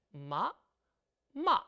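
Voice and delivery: "마" short, rising then falling; second and fourth tone, brief; short even tones. Shown as a demonstration. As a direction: clip both "마" short. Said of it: "짝수 성조는 짧게 소리 냅니다".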